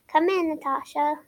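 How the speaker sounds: ordinary delivery, nothing marked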